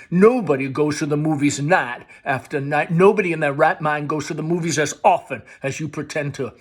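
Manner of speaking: loud, rising accusation; loud mother accusing her son. Acted. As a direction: tear into him.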